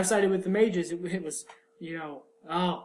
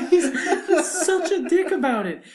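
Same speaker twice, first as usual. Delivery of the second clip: laughing; 12 semitones higher, 9 dB louder than usual. Real, not acted. Laughing while complaining about Carver.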